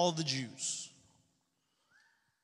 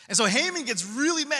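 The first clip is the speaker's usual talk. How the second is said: building anger; 5 semitones higher, 4 dB louder than usual. A narrator's reading. Haman angered, voice rises.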